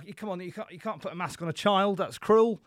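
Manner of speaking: ordinary talk, nothing marked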